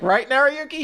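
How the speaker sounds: high, quick nudging question; a light schoolgirl prompt; prompting girl voice. Retold or imitated. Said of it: "She's like".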